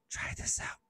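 unvoiced sly whisper; tempting whisper. Someone tempting Pamela, whispered. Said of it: "somebody says".

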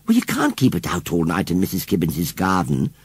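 usual speaking voice

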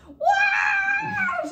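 imitating mom's loud high-pitched shriek of joy; shrieking wow. Mom hears she's having triplets.

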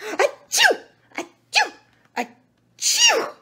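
very high, loud achoo sneezes; acted sneezes. A narrator's reading. The monkey wakes by the blue feather, loud acted sneezing. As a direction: sneeze explosively three times, storybook style. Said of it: "began to sneeze".

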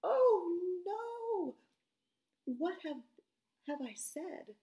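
high alarmed "Oh no"; Isaiah's alarm. Retold or imitated. Isaiah remembering his words, high alarmed voice. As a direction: panic at what he has said. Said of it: "his first thought was".

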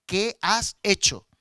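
very slow, loud stern question; serious reproof. Retold or imitated. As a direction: confront the child with weighty, separated words. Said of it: "pero me dijo las cosas serias".

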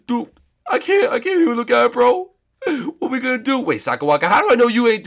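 high, loud shaken bro; high shocked dude voice. Acted. Friend reeling from the sight in a high, loud voice. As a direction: yelp it out, rattled.

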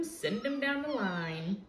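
ordinary delivery, nothing marked

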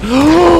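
a sudden high, startled gasp; sharp gasp. Real, not acted. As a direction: gasp as if caught off guard. Surprised by an enemy.

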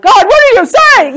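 loud, high forceful cry to God; forceful demand. Shown as a demonstration. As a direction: demand an answer loudly. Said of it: "that would be more normal".

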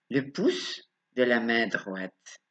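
ordinary delivery

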